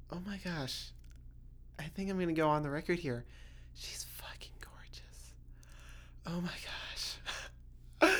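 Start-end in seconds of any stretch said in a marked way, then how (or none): 3.7-5.3 whisper. Real, not acted. Confessing a crush to camera. 6.3-6.6 whisper. Real, not acted. Gushing about her crush.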